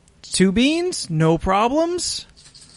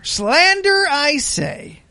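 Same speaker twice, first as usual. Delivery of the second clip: Slander leaps much higher in hammed-up outrage; mock indignant cry; 8 semitones higher, much slower than usual. Reading the letter's denial to friends, hammed-up high outrage. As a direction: fling the word up like a scandalised aristocrat.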